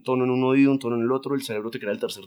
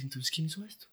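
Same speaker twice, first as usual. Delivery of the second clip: softer, puzzled question; baffled master; 4 semitones higher, 13 dB quieter than usual. Retold or imitated. Drunk painter wonders who drew the monkey, softer and baffled. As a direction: ask it under your breath, stumped. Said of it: "el tipo dice".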